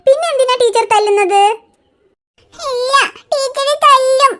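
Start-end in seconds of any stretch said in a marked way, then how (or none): 2.5-4.3 put-on slow, high, teary child voice; crying little girl. Riya tearfully refusing school to her aunt. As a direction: sob it out like a stubborn toddler. Child insists teacher will hit.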